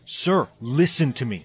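loud, insistent appeal to a superior; urgent protest. Acted. John to his boss over captive Maya, urgent protest.

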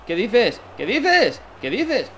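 high, repeated cries of disbelief; incredulous outburst. Real, not acted. Gamer protests at the machine's play, high-pitched disbelief. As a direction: fire it out high, peaking on the middle one. Incredulous at what happens in match.

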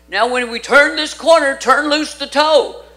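ski instructor husband. Retold or imitated. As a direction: call out the instruction over the wind. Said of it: "And he says".

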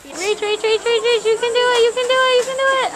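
high, rapid repeated cheering; urging on. Real, not acted. Cheering an animal to reach, high urgent encouragement.

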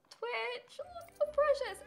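high, tender cooing at the dog. Real, not acted.

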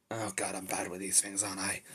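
low-volume self-reproach; embarrassed, quiet aside to yourself; awkward aside. Acted. Muttering awkward aside to himself.

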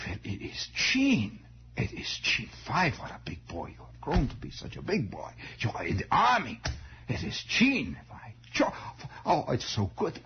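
put-on delighted old uncle with a German accent; soft, partly whispered German-accented greeting. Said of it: "they all had this vague German accent".

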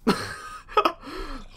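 short high, soft laugh. Real, not acted. Player laughing at his own mistake, high laugh. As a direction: laugh helplessly at the mishap.

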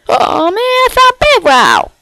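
high, loud outburst; loud exclamation. Real, not acted. Player discovers bedrock while digging down, loud exclamation. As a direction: call it out, pitched right up.

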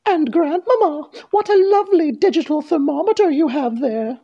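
put-on high-pitched little girl voice; Little Red. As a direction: question granny in a high storybook voice. Little Red questions grandmother.

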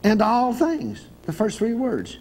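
slow solemn scripture reading. Acted. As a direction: read it out like holy writ. Preacher reads scripture slowly.

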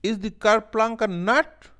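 very high, loud duck voice; astonished duck. A narrator's reading. Duck questions the lion, very high astonished voice. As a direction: squeak the question in disbelief. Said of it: "ducks ने कहा".

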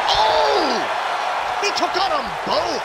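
excited shout. Real, not acted. Big move in the match.

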